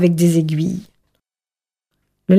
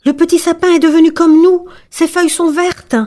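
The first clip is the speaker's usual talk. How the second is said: high, delighted character voice; exclaiming trees; 8 semitones higher than usual. A narrator's reading. Forest trees welcome the fir as one of them, exclaiming high. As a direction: exclaim it high, starting strong. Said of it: "Les arbres s'exclamèrent".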